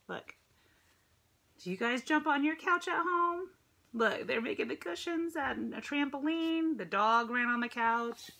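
ordinary delivery, nothing marked

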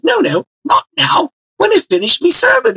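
put-on English preacher accent. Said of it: "with an English accent".

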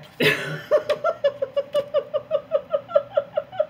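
high squealing laugh; another laughing fit. Real, not acted. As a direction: burst out laughing again.

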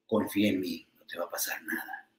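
creepy villain whisper; villain's whisper. Acted. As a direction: hiss it like a bad guy luring someone. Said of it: "los malos le dicen a los buenos".

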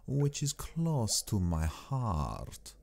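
put-on slow, stately accented Count with sweeping pitch; courtly Count Dracula. Count bidding his young guest farewell, slow and courtly. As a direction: draw out every word as a gracious, sinister host.

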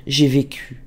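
plain reading voice

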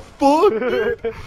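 ordinary delivery

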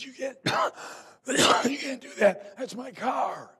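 high, loud, sputtering yell that chokes on a cough; a young man shrieking at the lady who hit his car; outraged shout. Retold or imitated. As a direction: howl it like a kid whose car just got rammed. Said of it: "He said".

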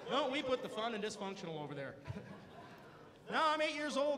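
ordinary talk

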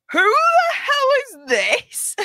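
very high, loud, drawn-out mock disbelief; jaw-dropped astonishment. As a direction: squeal it slowly, imitating a stunned friend. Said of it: "His jaw just dropped".

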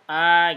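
long vowel demo. Shown as a demonstration. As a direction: stretch the A long. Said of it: "Kalau A-nya ini panjang".

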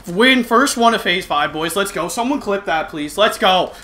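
loud victory yell; excited shouting. Real, not acted. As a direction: hype it up, yelling at the mic. Celebrating a game win.

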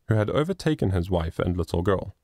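ordinary delivery, nothing marked

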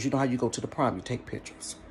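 ordinary talk, nothing marked

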